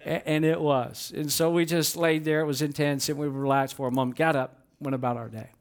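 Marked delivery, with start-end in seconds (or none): none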